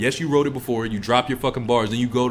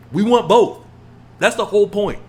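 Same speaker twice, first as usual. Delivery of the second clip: ordinary talk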